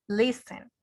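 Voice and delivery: "listen" with accent on LIS; stress on first syllable. Shown as a demonstration. Teacher confirming the accent for students, "listen" stressed on LIS. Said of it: "el acento está en, en la primera".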